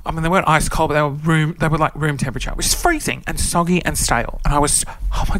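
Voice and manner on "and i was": ordinary delivery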